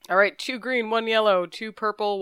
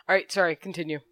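normal talking voice

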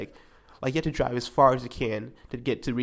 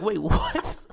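higher, retold disbelief; 6 semitones higher, 3 dB louder than usual. Telling friend of surprise charge, disbelief. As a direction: blurt it in mock disbelief.

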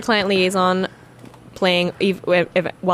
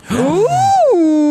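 high, loud delighted ooh; excited ooh; 12 semitones higher, 6 dB louder than usual. Real, not acted. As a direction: squeal with delight.

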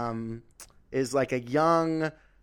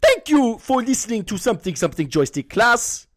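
put-on a young Sudanese-Australian primary teacher's accent, higher and louder; 6 semitones higher, 6 dB louder than usual. Young teacher says goodbye to his class, Sudanese accent. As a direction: play the eager new teacher dismissing class, in accent. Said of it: "I'll do it just once".

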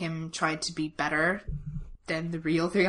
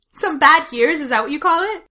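higher and much louder, incredulous; outraged disbelief; 8 semitones higher, 11 dB louder than usual. Retold or imitated. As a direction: blurt it out in shock. Theon voiced, outraged.

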